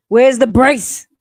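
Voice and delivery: loud demanding rant; outraged yell. Real, not acted. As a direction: yell it out, indignant. Ranting about trustee's brace.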